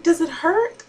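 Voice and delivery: put-on high, small child asking mommy; toddler voice. Mom imitating her daughter asking if she's hurt, high childlike voice. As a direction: pitch up like a worried little girl. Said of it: "she says".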